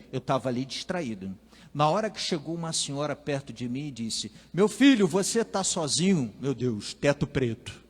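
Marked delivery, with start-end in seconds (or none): 4.5-6.3 put-on loud, high, worried question to a child; a stranger fussing over a lost kid; concerned old lady. A lady finds a lost little boy, worried and loud.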